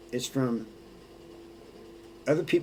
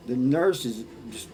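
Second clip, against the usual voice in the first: ordinary talk, nothing marked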